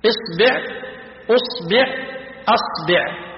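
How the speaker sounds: إصبع، أصبع، أصبع as isbi', usbi', asbi'; kasra on the ba. Shown as a demonstration. Teacher lists finger variants, إصبع، أصبع، أصبع each ending -bi'. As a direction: list إصبع، أصبع، أصبع changing the first vowel, i after b. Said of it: "بكسر الباء مثلثة الهمز".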